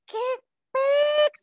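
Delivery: squeaky high, loud qué pex; mock exclamation. Real, not acted. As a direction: toss out pex like a shocked squeal. Exclaims about silent treatment. (exclamación aguda y burlona)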